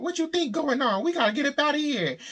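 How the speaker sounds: high, loud panicked cry; freaking out. Retold or imitated. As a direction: shout it in a frantic panic. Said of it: "She freaking out".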